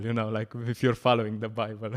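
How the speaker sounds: usual speaking voice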